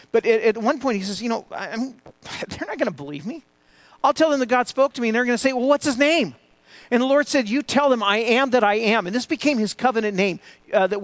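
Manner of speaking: loud, high, fast retelling of Moses and God; fervent pulpit voice; preaching at full force. Acted. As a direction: preach it out big to the congregation.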